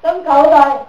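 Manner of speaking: normal talking voice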